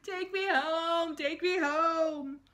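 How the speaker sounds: high, slow, wailing plea to go home; a scared little boy begs to be taken home; screaming child. A narrator's reading. Scared boy clinging to mother's leg, wailing high and slow.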